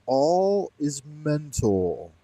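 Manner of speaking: drawn out very slowly; a slow, weighty mock-oracle read; slow solemn quote. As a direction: stretch every word of it slowly. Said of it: "He says".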